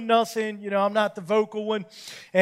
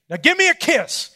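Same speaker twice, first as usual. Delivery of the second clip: imitating wife; 8 semitones higher, 5 dB louder than usual. Wife voiced, higher.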